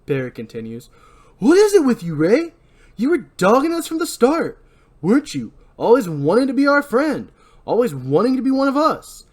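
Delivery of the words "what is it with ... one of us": high, loud accusing tirade; angry rant. Acted. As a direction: lay into him bitterly. Barry rants at Ray.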